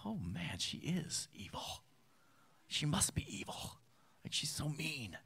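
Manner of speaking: whispered thought. Retold or imitated. Friend thinking the neighbor is evil, whispered. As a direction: hiss it as a private shocked thought.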